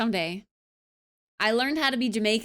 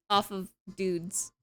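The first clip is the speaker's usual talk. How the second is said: normal talking voice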